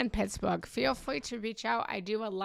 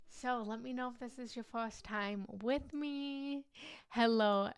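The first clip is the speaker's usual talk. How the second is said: normal talking voice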